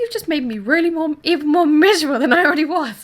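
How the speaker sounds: loud, high-pitched imagined outburst at a customer; indignant, heated mock telling-off; exasperated retort. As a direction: let the old frustration burst out. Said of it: "Can you hear the passion".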